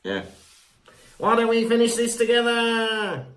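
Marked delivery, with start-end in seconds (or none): 1.2-3.3 high, hammed-up Australian imitation; mock Australian accent. Mocking co-host's stray accent, hammed-up Australian. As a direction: exaggerate the Australian accent, pitched way up. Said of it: "Why is he Australian again?".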